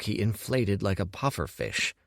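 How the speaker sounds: ordinary delivery, nothing marked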